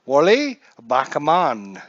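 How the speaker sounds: Frenchify Wally Backman; mock French. Joking Backman is French, mock French accent. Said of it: "Wally Backman's French, too".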